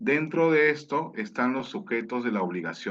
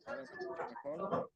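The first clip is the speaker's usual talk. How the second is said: faint, choppy distant audio; bad connection; 14 dB quieter than usual. Real, not acted. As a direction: voice breaking up far away. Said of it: "se mantiene igual".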